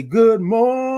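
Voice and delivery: MORNING held long and high; high, sustained sing-out greeting; drawn-out good morning. Shown as a demonstration. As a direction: stretch out the MOR in morning. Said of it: "you say it like that".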